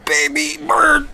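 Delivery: put-on very high, slow baby bird chirp. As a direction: squeak it like a hungry baby bird. Feeding mushroom, mouth open.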